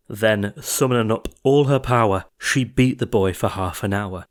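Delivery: ordinary delivery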